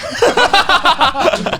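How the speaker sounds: loud high burst of laughter; big laugh. Real, not acted. Laughs at own brag.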